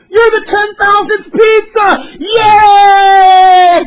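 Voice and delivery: very high, loud, slow shrieked announcement; mock screaming. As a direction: scream it in the delivery guy's face like a crazed prize host. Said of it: "He's just fucking screaming".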